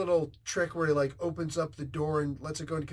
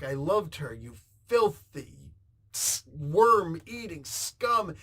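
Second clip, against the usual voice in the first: slow, louder, word-by-word contempt; halting, emphatic insult; 6 dB louder, slower than usual. Retold or imitated. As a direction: spit out the insult in separate, weighted chunks. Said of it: "it's great the way he's talking".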